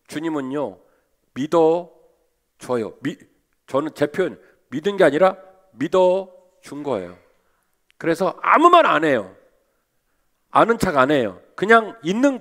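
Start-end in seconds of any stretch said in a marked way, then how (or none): none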